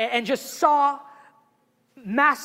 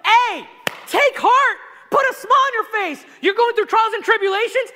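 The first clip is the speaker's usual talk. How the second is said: rousing encouragement; 9 semitones higher, 7 dB louder than usual. Retold or imitated. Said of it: "So James is going".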